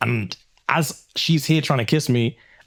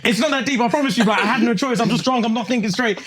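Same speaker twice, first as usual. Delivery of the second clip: pleading; 11 semitones higher than usual. Retold or imitated. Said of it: "I'm like".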